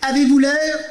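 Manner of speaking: mock old actor. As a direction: parody the ham actor's line. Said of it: "il joue vieux".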